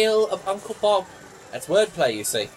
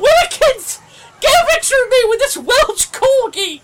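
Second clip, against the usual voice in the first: excited shout; 8 dB louder than usual. Acted. Delighted master calls to butler.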